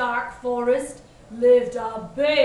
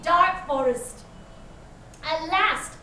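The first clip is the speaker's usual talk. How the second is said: plain reading voice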